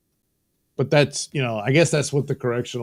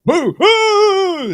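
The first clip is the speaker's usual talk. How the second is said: very high, loud ghostly boo hoo; shrill spooky mock cry; mock ghost wail; 8 dB louder than usual. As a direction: howl it like a cartoon ghost. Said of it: "You know".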